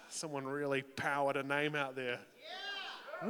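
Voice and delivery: usual speaking voice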